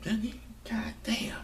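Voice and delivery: high, soft whispered letdown; whispered dismay; disappointed whisper. Real, not acted. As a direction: breathe it out in dismay. Disappointed by Sid.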